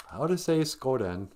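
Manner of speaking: ordinary delivery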